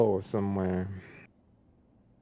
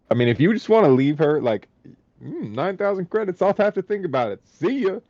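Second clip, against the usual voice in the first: higher, quick, offhand haggler; breezy merchant; 6 semitones higher than usual. Acted. Merchant shrugging off a lowball offer, higher breezy haggle. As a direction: toss the price out and wave them off.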